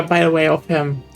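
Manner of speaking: normal talking voice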